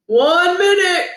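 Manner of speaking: high, loud, slow coach call; drawn-out gym coach shout. Acted. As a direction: bellow the time warning across the gym. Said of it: "Coach O shouted".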